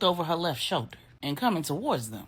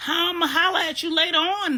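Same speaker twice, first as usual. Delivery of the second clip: called out, louder and higher; calling after her; 11 semitones higher, 6 dB louder than usual. A narrator's reading. Y calling after Salima as she leaves, raised voice. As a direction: call it across the room.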